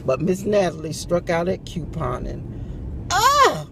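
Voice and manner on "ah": high loud holler; a real yell of frustration. Real, not acted. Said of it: "Can I holler again?".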